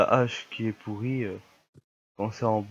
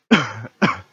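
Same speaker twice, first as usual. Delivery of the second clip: quick cough from a cold; 8 dB louder than usual. Real, not acted. Streamer with a cold coughing after checking the recorder. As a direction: cough once, then carry on. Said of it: "je suis un peu enrhumé".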